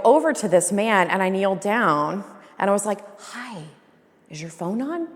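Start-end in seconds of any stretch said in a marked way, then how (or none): 3.2-5.1 soft, slightly raised polite voice; re-enacted discreet approach during a show; hushed confrontation. Retold confronting filmer during show.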